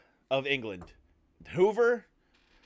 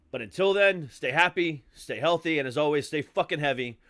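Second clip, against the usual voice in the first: rising, louder show outro; host sign-off; 4 dB louder than usual. Acted. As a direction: pump up the farewell to the listeners. Podcast sign-off.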